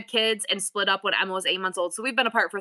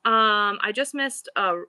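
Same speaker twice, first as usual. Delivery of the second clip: ordinary delivery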